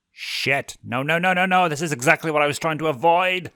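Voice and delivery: fast, frantic no no no; alarmed imp spiraling; panicked. A narrator's reading. Blitz panics.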